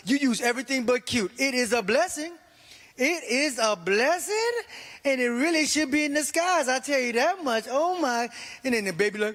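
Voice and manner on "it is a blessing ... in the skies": strained polite praise; fake politeness. Politely praising ugly baby.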